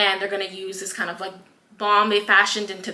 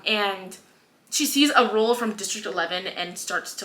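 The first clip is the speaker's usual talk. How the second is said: usual speaking voice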